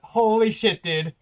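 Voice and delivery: loud, thrilled outburst; real joy at the record; excited shout. Real, not acted. Finishing a speedrun record.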